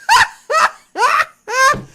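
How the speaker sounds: very high loud laughter; loud laughing fit. Real, not acted. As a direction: cracks up at himself. Cracking himself up.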